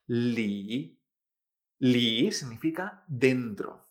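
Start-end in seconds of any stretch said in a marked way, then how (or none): 0.1-1.0 "Li" held low, dipping then lifting; third tone. Shown as a demonstration. Teacher demonstrates L I, "Li" in low third tone. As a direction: dip the pitch down through a drawn-out "Li". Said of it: "en tercer tono".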